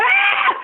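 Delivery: sudden high, loud shriek; startled shriek. Retold or imitated. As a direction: imitate her shocked scream at the card. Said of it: "she looks at the card and goes".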